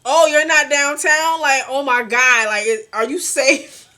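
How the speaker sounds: alarmed mother checking you're safe; worried mom. Retold or imitated. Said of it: "She's like".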